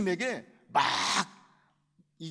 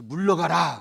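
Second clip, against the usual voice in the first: stern command; 8 dB louder than usual. Acted. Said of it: "그러신 거예요".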